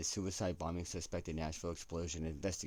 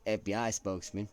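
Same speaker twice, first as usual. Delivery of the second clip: louder, measured newsreader tone; hammed-up broadcast read; news anchor accent; 5 semitones higher, 9 dB louder than usual. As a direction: deliver it like a network anchor. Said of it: "I don't know why I got into that accent".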